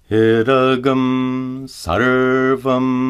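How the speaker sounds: slow Idagam sarvam with gum at the back of the throat; Sanskrit example. Shown as a demonstration. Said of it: "Notice that the anuswara is pronounced gum".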